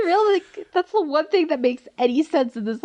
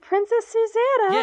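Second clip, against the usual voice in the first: higher, hammed-up formal title; mock courtly address; 10 semitones higher than usual. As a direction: announce her royal name with mock grandeur.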